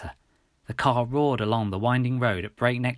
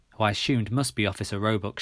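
ordinary delivery, nothing marked

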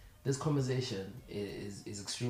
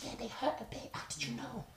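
high whisper; 12 semitones higher, 5 dB quieter than usual. Retold or imitated. Friend answering him in secret.

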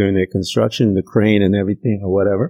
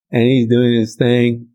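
normal talking voice

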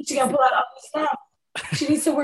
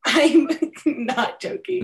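laughing; 3 semitones higher, 4 dB louder, slower than usual. Real, not acted. Laughing after threat to hate-tweet.

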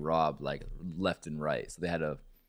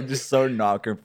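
laughing; 7 semitones higher, 9 dB louder, slower than usual. Real, not acted. Laughing at a friend's story.

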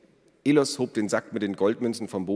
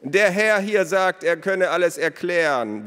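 calling out; 10 semitones higher, 6 dB louder than usual. A narrator's reading. Father calls news to distant son. (langsam und laut hinübergerufen)

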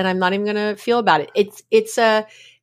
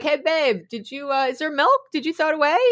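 higher, lightly innocent questioning; oblivious partner; 7 semitones higher than usual. Acted. Partner casually asking about the milk, oblivious. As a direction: ask casually, with no idea anything is wrong. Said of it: "totally oblivious".

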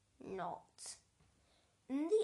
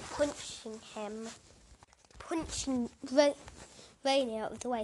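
plain reading voice

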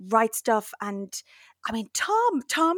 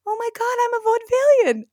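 much higher, louder, thrilled squeal; high, giddy self-congratulation; giddy delight; 13 semitones higher, 6 dB louder than usual. Retold or imitated. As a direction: burst with joy at the discovery. Happy wave after Googling.

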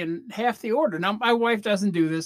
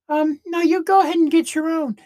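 higher, louder, pointedly polite refusal; icy girlfriend refusal; 9 semitones higher, 8 dB louder than usual. Acted. Girlfriend refusing to share her dessert, high and pointed. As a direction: sweetly snap back with a warning underneath.

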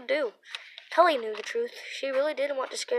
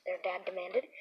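ordinary delivery

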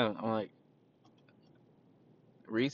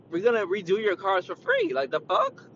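exasperated retort; 9 semitones higher, 4 dB louder than usual. Retold or imitated. Retold outburst at customer.

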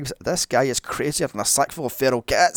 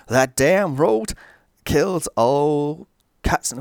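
slow, louder, badly faked Maine old-timer accent; attempted Maine accent; 2 semitones higher, 5 dB louder, slower than usual. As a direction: drag out a clumsy Maine drawl, slowly. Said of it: "I'll try the accent".

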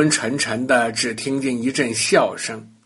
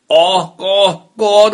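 loud throaty toad croaks; croaking noise; 4 semitones higher, 7 dB louder, slower than usual. Acted. Bully turned toad can only croak. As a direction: croak like a toad instead of words. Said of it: "只是发出…的声音".

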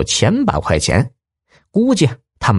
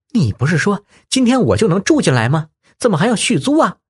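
exasperated; 8 semitones higher, faster than usual. Acted. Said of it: "她气急败坏地说".

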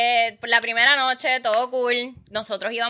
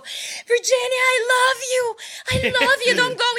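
high, slow, hammed-up begging; mocking the guy begging her to stay; mock pleading lover; 12 semitones higher than usual. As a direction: whine it like a desperate clingy man. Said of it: "el tipo".

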